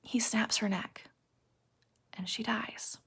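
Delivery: very soft, voice almost gone; hushed near-whisper. A narrator's reading. Teacher telling the class of her death, hushed. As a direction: drop to a slow, grave whisper.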